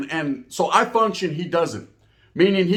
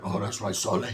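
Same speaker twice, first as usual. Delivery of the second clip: low, soft, easy reply from a big man; a big cellmate's calm, deep greeting; deep Samoan cellmate; 9 semitones lower, 8 dB quieter than usual. Retold or imitated. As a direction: drop the voice way down and keep it quiet. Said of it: "He's like".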